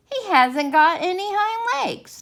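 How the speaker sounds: high, loud, slow jeering rabbit; mocking furry rabbit. A narrator's reading. Furry rabbit jeering at the toy rabbit, high and loud. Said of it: "the furry rabbit called out".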